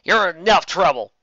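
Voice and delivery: loud exasperated telling-off; loud scolding. Acted. Big sister scolding the runaway fillies, loudly. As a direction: scold them hard. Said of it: "Applejack scolded".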